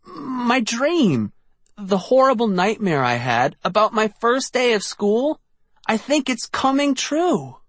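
high, breathless panic; panicked. A narrator's reading. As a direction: blurt out the nightmare in dread. Artie panicking about his dream.

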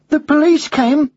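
high, drawn-out schoolboy question; surprised boy. Acted. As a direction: ask in a child's astonished voice. Boy astonished by the news.

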